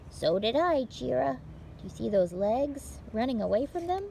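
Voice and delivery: bright, lively read-aloud for little listeners; picture-book reading. Acted. Librarian reading a picture book to children, lively read-aloud. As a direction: tell it like story time for small children.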